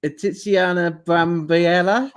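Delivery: slow careful "Tiziana Brambiella", rising as if asking; unsure Italian name. Real, not acted. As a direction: sound out "Tiziana Brambiella" hesitantly, ending on a question. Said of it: "I excuse my pronunciation".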